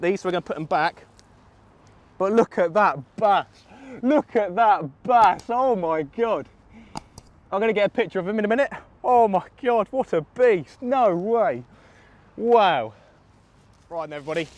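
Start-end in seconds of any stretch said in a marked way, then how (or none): 2.2-6.5 slow, louder, higher marvelling; showing the big bass to camera; awestruck. Real, not acted. 9.0-12.9 higher and louder amazement; gasping at the fish; awestruck. Real, not acted. Admiring the big fish.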